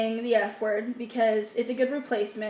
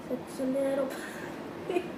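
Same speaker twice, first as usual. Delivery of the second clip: puppy talk; 9 dB quieter, slower than usual. Real, not acted. Cooing at a puppy.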